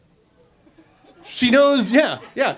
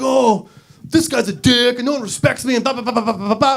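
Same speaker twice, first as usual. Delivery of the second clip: higher-pitched griping, running off into blahs; mock upset; 4 semitones higher than usual. Man tells his girlfriend his problems, mock upset. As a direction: whine out a complaint, hammed-up. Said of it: "That's how he sounds when he's upset".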